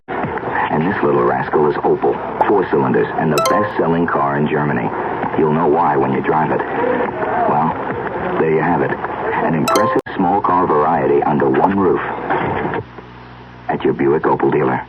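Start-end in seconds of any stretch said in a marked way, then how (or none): none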